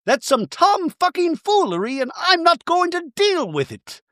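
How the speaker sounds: very high, hammed-up indignation; outraged Bronwyn. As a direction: play an affronted reality star. Plays outraged Bronwyn in talking head.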